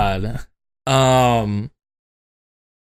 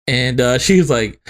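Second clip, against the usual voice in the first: normal talking voice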